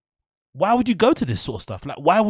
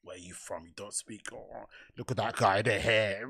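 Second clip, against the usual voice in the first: muttered mock crowd work; 7 semitones lower, 11 dB quieter than usual. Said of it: "mock crowd work again".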